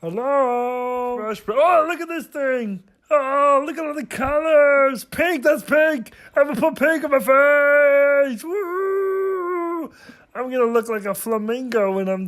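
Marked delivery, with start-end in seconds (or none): 1.5-8.4 higher-pitched excited exclaiming; excited discovery. Real, not acted. Vlogger thrilled by pink makeup, excited. 8.4-9.9 long high-pitched whoop; high woo. Real, not acted. Vlogger cheering pink on face, high whoop.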